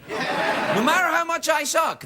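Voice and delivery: high slow laughing shout; mock outburst. Host mocks his own show, shouting slowly. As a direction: bellow it at yourself, laughing. Joking about himself.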